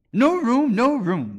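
loud, high cry. A narrator's reading. March Hare and Hatter crying out at Alice, loud. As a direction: shout it out in protest. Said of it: "they cried out".

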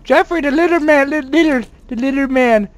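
"little man" said for "lizard man", tongue tripping on "li-"; tongue-tied "little man" where "lizard man" is meant; fumbling lizard. Real, not acted. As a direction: stumble so "lizard" comes out "little". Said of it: "I don't know why I can't say lizard".

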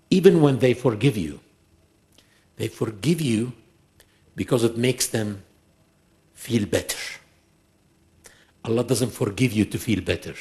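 usual speaking voice

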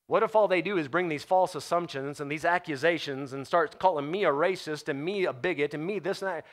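ordinary talk, nothing marked

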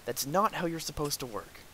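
normal talking voice

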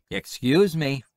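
loud, high call across a dark hall. A narrator's reading. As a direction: call out to someone up the stairs. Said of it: "Randolph called".

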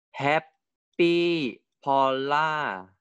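stretched-out slow reading of the name; slow title read. Shown as a demonstration. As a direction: read the name out slowly for the class. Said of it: "this passage is about a particular someone".

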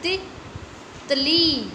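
तितली with short ति, long ली drawn out; teacher modelling तितली for children; stretched reading. Shown as a demonstration. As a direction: say तितली slowly, stretching the last ली. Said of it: "फटाफट से बोल रहे हैं".

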